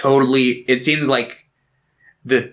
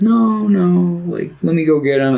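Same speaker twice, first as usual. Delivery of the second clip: mock pleading; 7 semitones higher than usual. Frodo voiced pleading for Gollum.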